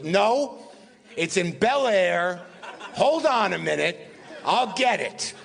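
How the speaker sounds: high, emphatic mock exasperation; Mel Brooks. Mel rejecting the wrong hotel, hammed-up exasperation. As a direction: imitate someone insisting, pitched well up. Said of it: "He goes".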